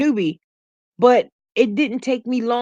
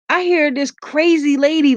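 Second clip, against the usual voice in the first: usual speaking voice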